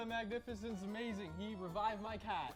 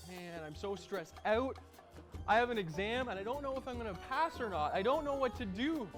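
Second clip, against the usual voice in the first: higher, worried student voice; anxious student; 6 semitones higher, 4 dB quieter than usual. Acted. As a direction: fret about the exam. Stressed student in ad.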